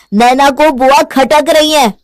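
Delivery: slow, loud, high accusation; angry shout. Acted. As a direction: announce it loudly, stretching the words. बेटा घर के झगड़े में चिल्लाता. (धीमे-धीमे चिल्लाकर आरोप)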